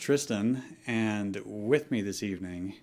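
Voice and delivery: ordinary talk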